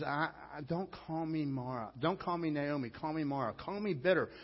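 normal talking voice

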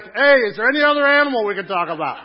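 loud, high, laughing whine; laughing mock complaint. Preacher imitating a weary congregation member, loud and laughing. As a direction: play the bored listener, laughing. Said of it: "You're like".

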